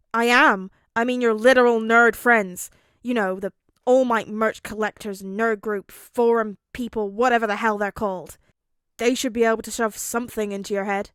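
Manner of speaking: brash loud explanation; loud gruff rant. A narrator's reading. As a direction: rant it loudly and rudely. Katsuki rants loudly.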